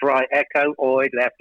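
usual speaking voice

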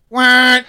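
high, loud honk of a horn; imitated car horn. Patrice's horn blasting at the cop, loud honk. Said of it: "really loud horn".

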